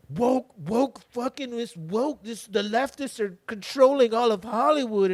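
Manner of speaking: high, loud mock outrage; mocking complainer. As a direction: imitate a ranting internet complainer. Mimicking complainers about woke media.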